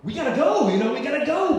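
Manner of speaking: high, loud, urgent pleading; exasperated, high-pitched yell; silent plea screamed. Retold or imitated. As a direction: cry out what you wish he could hear. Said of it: "sort of telepathically screaming at him".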